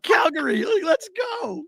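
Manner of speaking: high excited shout for the team; root for Calgary at full voice; cheering shout. Retold or imitated. Cheering his team on his watch alert, shouted high. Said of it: "I was like".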